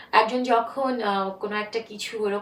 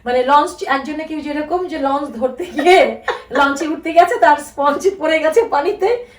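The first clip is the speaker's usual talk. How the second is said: normal talking voice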